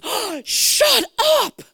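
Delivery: stunned, drawn-out high yell; gasp, then shout it in loud, high disbelief; freaking out. Retold or imitated. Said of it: "he's just freaking out".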